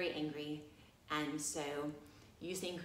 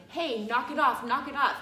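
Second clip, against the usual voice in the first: loud, higher mock telling off; pushing back; 6 semitones higher, 8 dB louder than usual. Her old habit of telling students off, loud mock scolding. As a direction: snap at them to stop. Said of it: "like, pushing back".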